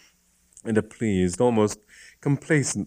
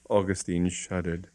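usual speaking voice

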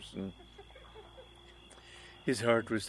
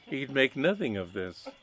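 usual speaking voice

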